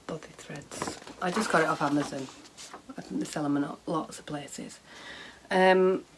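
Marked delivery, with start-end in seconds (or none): 0.1-1.0 whispered aside. Real, not acted. Muttered repeat of name.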